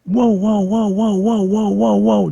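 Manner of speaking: high, loud panicked whoas; repeated frantic high whoa shouts; loud alarmed yelling. Retold or imitated. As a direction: yell whoa over and over in alarm. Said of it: "like that, really loud".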